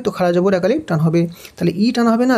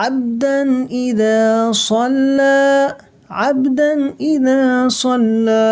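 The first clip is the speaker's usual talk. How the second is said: slow, higher chanted recitation; Quran recitation; 5 semitones higher, much slower than usual. Shown as a demonstration. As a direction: recite the verse drawn out and melodic. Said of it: "Quran recitation of the verse".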